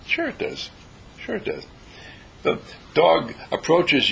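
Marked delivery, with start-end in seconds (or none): 0.1-0.7 breathy whispered reassurance; soft whispered certainty. Shown as a demonstration. Quiet affirmation, repeated. 1.2-1.6 quietly voiced, barely above a murmur; hushed calm reassurance; soft spoken. Shown as a demonstration. Quiet affirmation, repeated.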